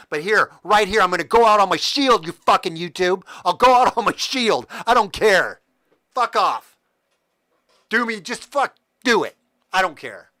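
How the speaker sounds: daring them to strike him, full volume; defiant shouting. Real, not acted. Defying YouTube over a strike, angry shouting. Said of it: "I'm getting fired up".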